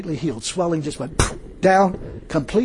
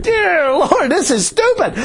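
high, drawn-out mock wail to heaven; comic exasperation; 11 semitones higher, 3 dB louder, slower than usual. Preacher retelling his protest to God, exasperated high wail. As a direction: plead in hammed-up disbelief, pitched way up.

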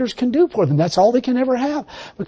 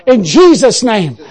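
loud, rising preaching prayer; fervent prayer; 6 dB louder than usual. Acted. Preacher leading the congregation in a louder, fervent closing prayer. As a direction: pray it out with full conviction.

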